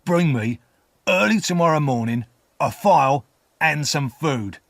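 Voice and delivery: repeated slowly. A narrator's reading. Convict repeats orders to the boy, slow and deliberate. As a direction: spell out the order in separate phrases. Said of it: "the man repeated slowly".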